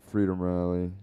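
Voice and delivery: ordinary delivery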